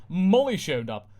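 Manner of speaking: ordinary delivery, nothing marked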